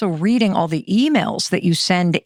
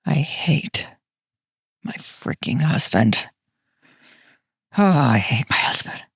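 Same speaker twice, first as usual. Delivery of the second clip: grumbling inner voice; 6 semitones lower than usual. Pretend. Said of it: "This is what my thoughts used to sound like".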